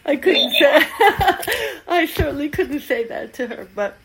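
high, loud words through laughter; laughing. Real, not acted. Teacher amused at the thought of preaching Zen to her daughter, laughing. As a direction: break up laughing while trying to get it out.

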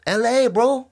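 high-pitched buddy-buddy impression; retold Mencia. Retelling Mencia inviting him to open in LA, chummy high voice. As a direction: imitate a chummy bro, pitched well up.

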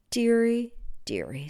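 put-on soft, sighing old man, fading away. Old shoemaker sighing to himself by the stove, mild sadness. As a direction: let the dearie fall into a sigh. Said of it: "sighed Papa Panov".

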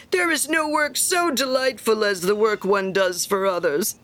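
emphatic, pompous pronouncement; self-important miller. Acted. Miller praising work done for others, pompous. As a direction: deliver a maxim with great satisfaction.

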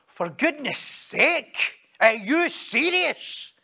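high, slow, swooping protest; exasperated disbelief. Shown as a demonstration. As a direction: stretch every stressed word in indignation. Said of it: "That's what he's saying".

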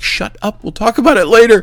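very high, strained retold hiss, getting loud; panicked hushing. Boss silencing technicians in class, mock panic.

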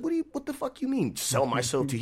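high, indignant mock outrage; a retold flash of offended anger; offended retort. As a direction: throw it back at him, insulted. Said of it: "It pissed me off".

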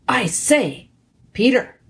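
ordinary delivery

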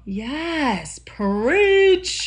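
high, loud cheer; hyped exclamation. Real, not acted. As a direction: call out Preach. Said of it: "Yes. Yes".

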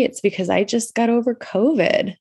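congested after an illness; stuffy nose. Real, not acted. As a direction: speak with a blocked nose. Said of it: "I sound stuffy".

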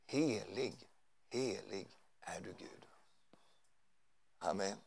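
slow, very soft reverent prayer, trailing into near whisper; softly adoring, fading almost to nothing; hushed worship. Real, not acted. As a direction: breathe it slowly to God in awe. Preacher turns to reverent worship.

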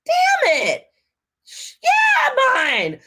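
high, loud mock dismay; exasperated outburst. Woman inwardly reacting to her date's ride request, exasperated. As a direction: draw out a hammed-up exasperated protest.

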